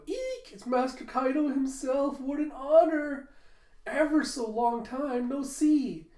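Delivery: very high, gushing fake fancy lady; Ulti. Ulti greeting Master Kaido, squealing and fawning. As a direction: squeal with exaggerated ladylike delight.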